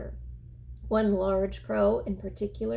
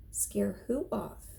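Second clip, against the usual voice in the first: plain reading voice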